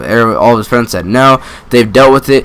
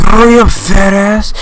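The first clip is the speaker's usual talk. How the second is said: high, jeering friend yelling at him; mocking taunt; 9 semitones higher, 3 dB louder than usual. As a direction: taunt him to hurry, mock-shouted. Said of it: "everybody was like".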